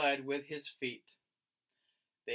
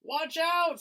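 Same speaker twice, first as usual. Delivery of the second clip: high, loud warning cry; sudden alarmed cry; cried out; 12 semitones higher, 7 dB louder than usual. Acted. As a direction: yell a warning down the slope. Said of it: "someone cried".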